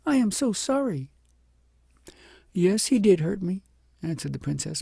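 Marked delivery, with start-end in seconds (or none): 0.0-1.1 put-on much higher, louder, concerned child; a small girl worried she caused hurt; sweet child asking gently but high; little girl voice. A narrator's reading. 2.5-3.6 higher, louder, owning her fault; a humbled princess admitting it; rueful princess. A narrator's reading. Narrator voices princess.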